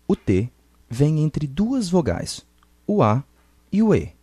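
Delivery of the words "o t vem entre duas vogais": normal talking voice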